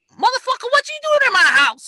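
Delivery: high, fast, startled yell; acted outraged shout. Homeowner confronts an intruder in a split second, outraged shout.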